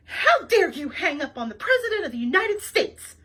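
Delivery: loud, furious demand; indignant caller shouting down the phone; angry shout. A narrator's reading. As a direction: bellow it at him in outrage. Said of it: "shouted an angry voice".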